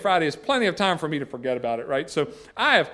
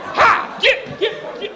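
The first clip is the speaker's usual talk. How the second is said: much higher, loud shooing yell; yelling at dog; 4 dB louder, much slower than usual. Retold or imitated. Chasing the dog away.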